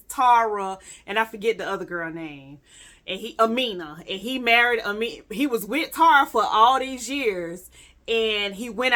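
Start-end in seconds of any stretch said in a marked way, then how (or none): none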